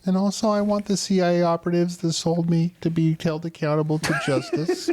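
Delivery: hammed-up little girl; mock child demand. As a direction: play the kid demanding justice, half laughing. Joining the parody girl.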